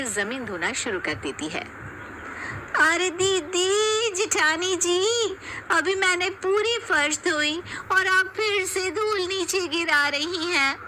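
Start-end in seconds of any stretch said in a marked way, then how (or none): 2.8-10.8 high, slow, drawn-out complaint; annoyed youngest bahu. Acted. Youngest daughter-in-law complaining to her sister-in-law, high and slow. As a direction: scold the elder for dropping dust.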